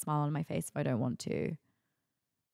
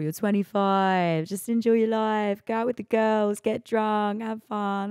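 higher, louder matey cabbie voice; Essex cab driver; 4 semitones higher, 4 dB louder, slower than usual. Retold or imitated. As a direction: imitate a chirpy Essex cabbie giving advice. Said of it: "this Essex guy".

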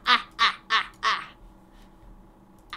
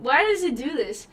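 normal talking voice

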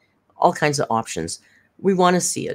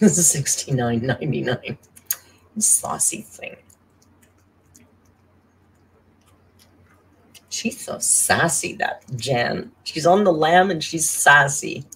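sassy teasing voice; slower than usual. Pretend. Teasing a donor in a put-on voice.

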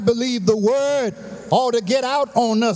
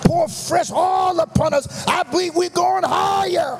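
rising, shouted sermon cadence, higher and driving; whooping preacher building to a climax; full-force preaching; 4 semitones higher than usual. Acted. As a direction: preach it up to the rafters.

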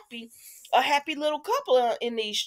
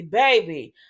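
ordinary delivery

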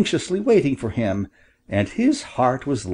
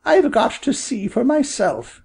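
high-pitched little mother quail; high storybook bird voice, doubting; mother bird, doubtful; 12 semitones higher than usual. Acted.

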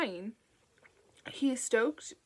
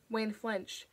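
ordinary delivery, nothing marked

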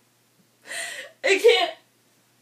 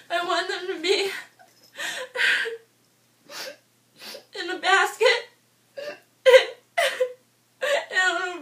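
crying; 3 semitones higher than usual. Real, not acted. Vlogger listing what she wants for cats, crying and laughing. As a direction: gush about cats while sobbing and giggling. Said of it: "I promised myself I wouldn't cry".

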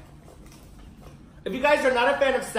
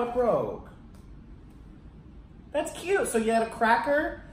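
usual speaking voice

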